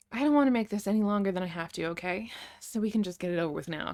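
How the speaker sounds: plain reading voice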